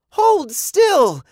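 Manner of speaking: high, loud urgent order; shout. A narrator's reading. Girl trying to untangle the robot, urgent shout. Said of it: "Eva shouted".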